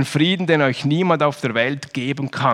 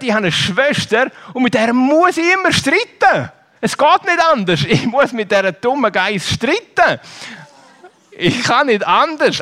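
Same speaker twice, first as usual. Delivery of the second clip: put-on a higher child's voice; quoting Julia; 7 semitones higher than usual. Preacher imitating a girl complaining about her sister. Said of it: "Also sie sagt".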